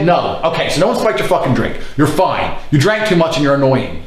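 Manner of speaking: ordinary talk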